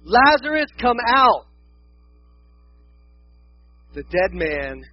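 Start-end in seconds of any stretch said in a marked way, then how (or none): 0.0-1.4 high, commanding call; big raised voice of command; loud call. Acted. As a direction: call out loudly to summon someone. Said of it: "called out in a loud voice".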